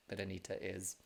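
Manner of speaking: ordinary delivery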